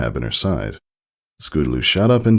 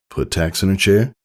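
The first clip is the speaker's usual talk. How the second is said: ordinary delivery, nothing marked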